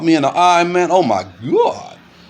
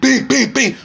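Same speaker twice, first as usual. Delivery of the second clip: punch sound effects; 9 semitones higher, slower than usual. Retold or imitated. Imitating punches in a fight.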